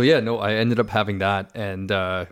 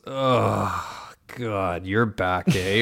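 teasing corporal; much slower than usual. Retold or imitated. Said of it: "he squeaks over and he goes".

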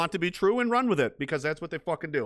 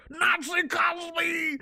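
character shout; 13 semitones higher, much slower than usual. Pretend. Announcing his comedy character.

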